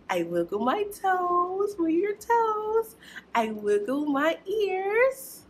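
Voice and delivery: slow sing-song action rhyme; wiggle rhyme. Acted. Teacher leads preschoolers in a wiggle rhyme, sing-song. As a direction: lead the little ones through the wiggles.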